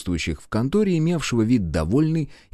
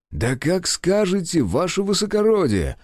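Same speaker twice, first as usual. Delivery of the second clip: higher, slow, with a nasty smirk; mock-compliant officer, higher and slow; 10 semitones higher than usual. A narrator's reading. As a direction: sneer it with a smile you can hear. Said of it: "с дрянной улыбочкой ответил Толстой".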